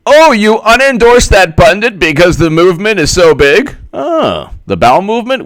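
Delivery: high, loud mocking incredulity; sarcastic. Mocking Bernie's excuse for unendorsing, sarcastic and high. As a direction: scoff at the excuse.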